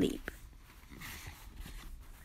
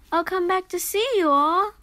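called out high and louder; calling out; 6 semitones higher, 5 dB louder, faster than usual. A narrator's reading. Tractor calling goodbye to the animals, raised voice. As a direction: call back cheerfully across the farmyard. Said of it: "Landy called to the animals".

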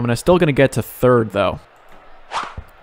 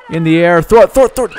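much higher and louder, shouting throw it at the screen; urgent shout; 5 semitones higher, 5 dB louder, faster than usual. Real, not acted. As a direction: yell at the player to throw, now. Urging the fielder to throw.

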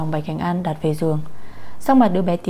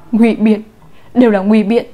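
loud furious accusation; distraught outburst of blame; angry shout; 2 semitones higher, 8 dB louder than usual. A narrator's reading. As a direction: cut the excuse off, very loud. Mother angrily rejects the excuse.

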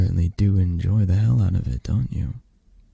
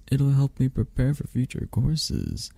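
higher, put-on mimicking voice; mock your own naive reasoning in a higher voice; self-mocking quote; 6 semitones higher than usual. Grumbling alone about a calculus class, self-mocking quote.

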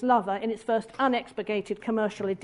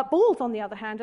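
usual speaking voice

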